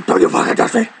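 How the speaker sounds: winded airy threat; breathless warning. Retold or imitated. Exhausted girlfriend warns him off. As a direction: hiss it out of breath.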